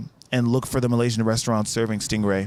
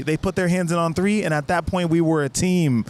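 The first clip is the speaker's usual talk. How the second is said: ordinary talk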